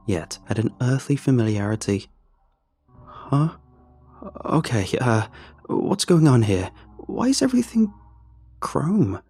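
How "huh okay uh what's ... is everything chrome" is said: baffled, looking around; confused. Acted. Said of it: "I said, confused".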